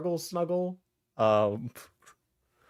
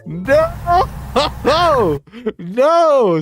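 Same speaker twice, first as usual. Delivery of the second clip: loud high laughing protest; shouting no; 12 semitones higher, 9 dB louder than usual. Real, not acted. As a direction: yell no in horror. Shocked reaction to clip.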